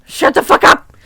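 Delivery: loud, harsh bark; shout. Acted. Teacher yelling at the class to shut up, loud bark. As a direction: bark it at them. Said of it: "shouted Cornelia".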